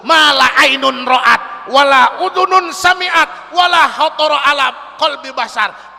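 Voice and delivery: loud ringing Arabic recitation; hadith proclaimed. Real, not acted. Preacher proclaiming a hadith to the congregation, loud. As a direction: declaim each line to the hall.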